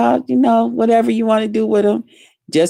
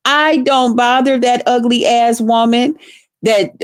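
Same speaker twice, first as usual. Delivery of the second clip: ordinary talk, nothing marked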